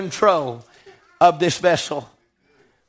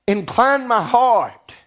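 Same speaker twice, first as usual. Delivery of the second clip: slow emphatic phrase; slower than usual. Real, not acted. Preacher repeats verse slowly.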